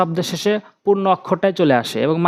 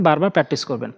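normal talking voice

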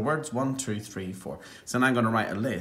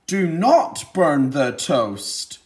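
slow, louder command on "Do not burn the toast."; with authority; 4 semitones higher, 7 dB louder, much slower than usual. Shown as a demonstration. Teacher showing how an exclamation mark sounds, with authority. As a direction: say it like a firm order. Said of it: "say it with a bit of authority".

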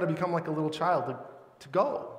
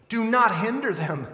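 loud, raised-pitch indignant command; 8 semitones higher, 7 dB louder than usual. Retold or imitated. Jesus angrily rebuking disciples who stop the children, indignant. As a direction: scold with forceful, high emphasis. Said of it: "He was angry. And he said to them".

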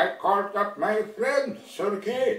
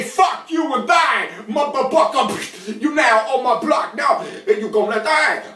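furious shout; 3 semitones higher, 4 dB louder than usual. Acted. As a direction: scream the threat at him like a street thug. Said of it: "He said to me".

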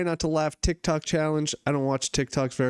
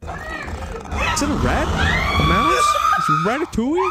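surprised guessing; 3 dB louder than usual. Real, not acted. Guessing creature in video.

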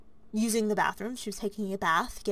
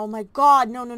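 panicked exclamation; 9 dB louder than usual. Acted. Panic over sister in danger.

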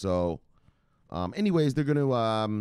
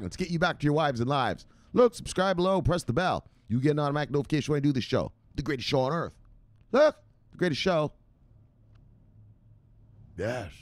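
high, pumped sign-off with a fast plug; hyped outro; 6 semitones higher than usual. Acted. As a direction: sell the channel like a showman closing the show.

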